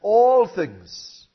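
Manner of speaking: rising sermon voice; preaching. Acted. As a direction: proclaim it from the pulpit. Opening praise of God.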